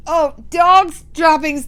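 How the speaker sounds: high loud shout. Acted. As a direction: shout it out in disgust. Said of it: "they shouted".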